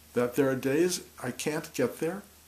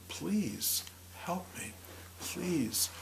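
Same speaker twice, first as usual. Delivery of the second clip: higher, softer plea, like a near-sob; soft pleading; 5 semitones higher, 7 dB quieter, much slower than usual. Retold or imitated. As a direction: ask for help gently, almost crying. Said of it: "of deeply asking".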